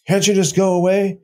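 high, sneering mimic of the troll; mocking imitation. Streamer mocking a troll's words back at him, sneering.

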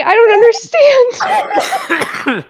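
slow, squeezed out through laughter; barely getting words out while laughing. Real, not acted. Laughing too hard to talk.

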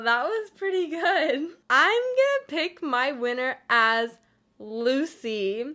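usual speaking voice

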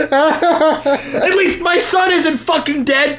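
laughing through a shout; cracking up mid-line. Real, not acted. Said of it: "[name] shouted".

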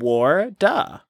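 "War, duh." split into two beats, war then duh; broken-down water. Shown as a demonstration. Co-host breaking the word down as a lesson, syllable by syllable. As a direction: say "War, duh." as two slow separate parts, Australian style. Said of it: "break it down like I just did".

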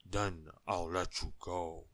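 slow, ponderous giant voice. A narrator's reading. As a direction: grant it heavily, as a huge giant. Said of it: "said the giant".

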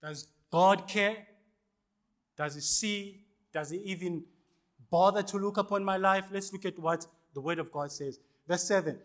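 ordinary talk

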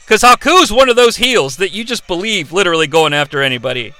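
ordinary talk, nothing marked

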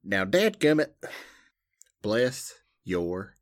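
hammed-up Southern huff; mock-scolding Southern. Mock Southern scolding, then drawled blessing.

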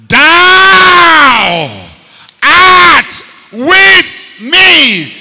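loud, high, very slow cry; shouted psalm line. Real, not acted. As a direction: stretch it out as a cry of faith.

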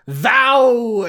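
hammed-up holy proclamation; mock biblical thou. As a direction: declaim it like a pompous scripture reader. Mocking Bible language.

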